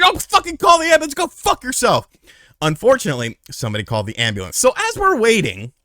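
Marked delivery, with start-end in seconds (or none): 0.0-2.1 high, loud angry snap; angry retort. Retold or imitated. Telling a kid not to call the ambulance, loud angry. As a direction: shoot down the idea hard.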